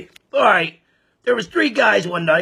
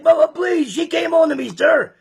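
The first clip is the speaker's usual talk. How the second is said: much higher, panicky mock begging; a retold guy begging for his life, pitched way up; pleading guy; 10 semitones higher than usual. Guy begging farmer at gunpoint.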